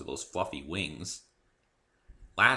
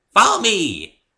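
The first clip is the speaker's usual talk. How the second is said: put-on much higher, louder giddy pony girl; bubbly Pinkie Pie; 12 semitones higher, 16 dB louder, slower than usual. A narrator's reading. Cheerful pony introducing herself to Dave, high and bubbly. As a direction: chirp it out like an overexcited pony.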